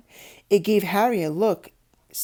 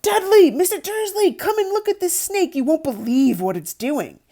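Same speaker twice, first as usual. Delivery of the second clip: much higher and louder excited yell; deafening shout; 10 semitones higher, 5 dB louder than usual. A narrator's reading. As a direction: yell across the reptile house to call them over. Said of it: "a deafening shout behind Harry".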